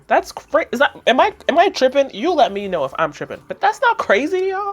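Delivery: high, loud disbelief; high-pitched what-is-going-on outrage; high, loud outraged disbelief; incredulous. Real, not acted. Outraged at neglect.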